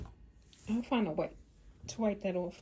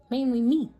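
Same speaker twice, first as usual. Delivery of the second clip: punched out much louder; emphatic; 4 semitones higher, 10 dB louder than usual. Retold or imitated. Telling son his wording scared her, emphatic loud punchline. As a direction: land the joke on yourself. Joking she was the one scared.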